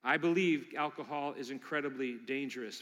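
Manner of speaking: normal talking voice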